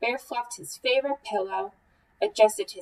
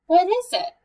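high, loud startled question; jolted awake; 9 semitones higher, 9 dB louder than usual. Acted. Mouse woken in alarm.

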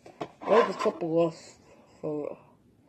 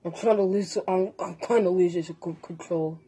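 mouth full; 3 semitones lower, 5 dB quieter, faster than usual. Real, not acted. Mouth stuffed with tangerines.

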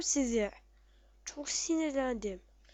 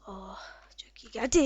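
whisper; 4 semitones lower than usual. Real, not acted. Relief after health pack.

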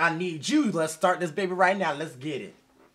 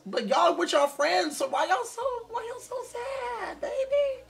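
high wailing plea. Real, not acted. Crying out at sad characters.

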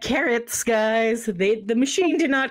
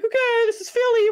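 mock announcement; 14 semitones higher, 3 dB louder than usual. Driver drops them under bridge.

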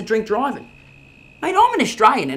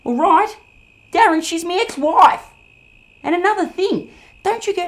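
loud, higher outraged retort; indignant outburst; 8 semitones higher, 6 dB louder than usual. Acted. Kane exploding at Darren over his ex-wife, indignant shout. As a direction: blow up at him. Outraged reply about Rhonda.

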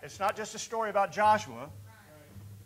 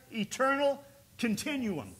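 ordinary talk

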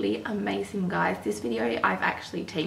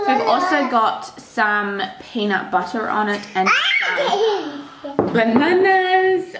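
talking through giggles; laughing; 6 semitones higher, 7 dB louder, slower than usual. Real, not acted. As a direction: laugh while saying it.

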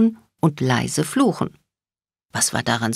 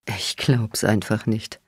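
chuckling; 5 semitones lower, 4 dB quieter, slower than usual. A narrator's reading. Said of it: "kicherte Bones".

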